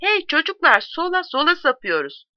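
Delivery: high-pitched narrated call; crying out. A narrator's reading. Maulu tells the men they're turning left, crying out. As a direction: call out to the whole wagon. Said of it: "Maulu haykırıyor".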